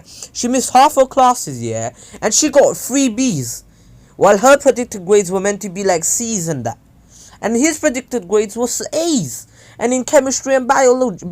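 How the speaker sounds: loud, high-pitched angry rant; worked-up ranting at the grading system. Real, not acted. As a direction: vent the outrage loudly. Ranting about unfair grades.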